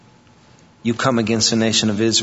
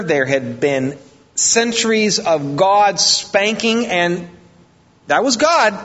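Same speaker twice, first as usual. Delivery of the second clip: high, slow, emphatic pulpit cadence; preacher dwelling on centuries of wrath; preaching at full force; 8 semitones higher, slower than usual. Acted. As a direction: drag each phrase out, preaching hard. Preacher's rising passage on God's anger.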